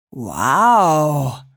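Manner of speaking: low, drawn-out awe; murmured amazement. A narrator's reading. Dad spots something in the sky, murmuring in amazement. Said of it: "murmelte er".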